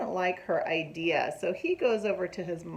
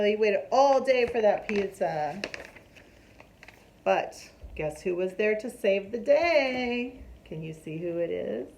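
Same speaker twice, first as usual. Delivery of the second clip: plain reading voice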